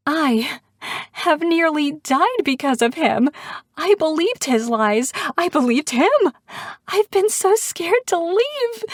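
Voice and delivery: high, hysterical gasping outpour; hysterical, pitch climbing toward a squeal; high-pitched and uneven. A narrator's reading. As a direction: gasp it out in a high, breaking voice. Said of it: "The words come out high-pitched and uneven".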